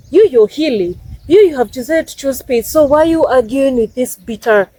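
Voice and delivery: ordinary talk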